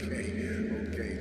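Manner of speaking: usual speaking voice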